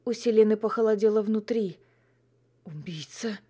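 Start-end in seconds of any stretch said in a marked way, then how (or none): 2.6-3.4 hushed inner voice, much softer; quiet thought. A narrator's reading. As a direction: think it, barely aloud. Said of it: "подумала она".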